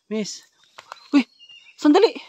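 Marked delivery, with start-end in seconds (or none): 1.1-2.1 shout. Real, not acted. Calling after fleeing woman.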